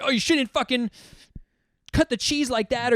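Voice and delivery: high-pitched mock nagging; worked-up scolding. Old deli worker scolding the twenty-year-old, mock worked-up. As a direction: imitate a fussy coworker getting worked up. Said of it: "they just get so worked up".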